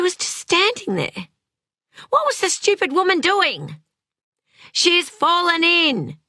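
high, urgent alarm; panicked outrage. A narrator's reading. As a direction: pitch jumps up in frantic disbelief. Panicking as nobody helps drowning girl.

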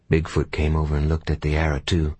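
ordinary delivery

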